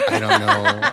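laughing. Real, not acted. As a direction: laugh it out helplessly. Repeats the line laughing.

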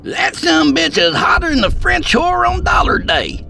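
high, loud hollered quip; shouting Skeet. A narrator's reading. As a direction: shout it over ringing ears. Skeet shouting after firing gun.

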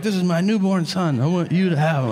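loud, overwrought offering; freaking out. Pretend. Imagined waiter handing over his newborn son, overwrought. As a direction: gush it like a grand gesture. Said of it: "freaking the fuck out".

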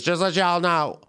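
imitated Memphis waitress, thick accent. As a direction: announce it in a broad Memphis accent. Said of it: "she had a very thick accent".

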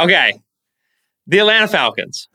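ordinary talk, nothing marked

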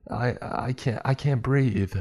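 put-on boy stuck in the tunnel, fighting tears; panicked, near tears. A kid stuck in a tight pipe, panicking near tears. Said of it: "I tried not to cry".

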